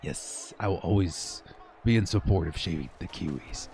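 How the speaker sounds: conspiratorial whisper. Pretend. Streamer secretly agreeing with chat about shaving the Kiwis, hushed whisper. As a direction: lean in and whisper your loyalty.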